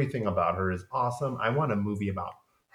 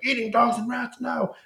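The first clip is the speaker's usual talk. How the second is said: high mock scoff; hammed-up high disdain; scoffing Lestat; 13 semitones higher, 4 dB louder than usual. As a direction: sneer at the idea in a squeaky voice. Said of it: "he's like".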